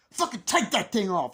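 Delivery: loud, high shouted command; mock angry order. Adult demanding the boy remove his mask, mock angry shout.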